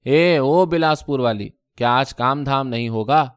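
plain reading voice

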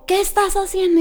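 high, loud accusing question; angry demand. Retold or imitated. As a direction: confront someone caught in the act. Retells man catching her on phone. (reclamo furioso)

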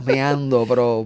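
ordinary talk, nothing marked

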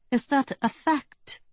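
put-on high-pitched, refined German accent; German-accented, pitched well up. A narrator's reading. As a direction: query it as a cool German villain. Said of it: "in a fine German accent".

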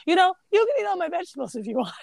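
put-on quick, bright little boy voice; eager kid. Percy offers Manny his vegetables in a quick childlike voice. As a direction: rush the generous offer like a child.

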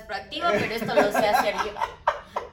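patronize them with a laugh; laughing condescension. Retold or imitated. Imitating condescending worker.

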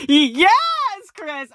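high, loud drawn-out cheer; excited cheer. Real, not acted. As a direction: let out a gleeful whoop of approval. Cheering the quote just read.

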